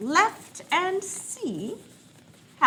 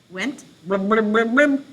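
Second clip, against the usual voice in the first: louder bubbly blums; underwater blubbing; 3 semitones higher, 8 dB louder than usual. Shown as a demonstration. Teacher reads underwater, louder bubbly blums. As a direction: gurgle the words underwater. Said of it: "pretend to be underwater".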